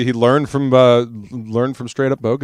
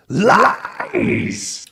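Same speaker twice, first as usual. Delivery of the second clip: spooky whispered echo; 10 semitones higher than usual. Pretend. Echoing the chat line spookily.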